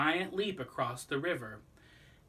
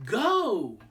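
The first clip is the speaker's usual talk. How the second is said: shouted; 9 semitones higher, 8 dB louder than usual. Acted. Countdown called to the jumper.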